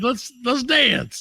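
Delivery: high, slow, goofy partygoer; mock cheerful. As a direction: sing it out like a silly reveler. Acted partygoer quote.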